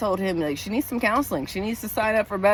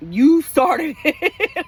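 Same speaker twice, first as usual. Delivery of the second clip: high, slow, louder, breaking into a laugh; childish laughing retort; 7 semitones higher, 6 dB louder, much slower than usual. Acted. To her husband, mock childish blame with a laugh. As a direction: drag it out like a bratty kid, then giggle. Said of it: "I'm such a child".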